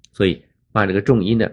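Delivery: normal talking voice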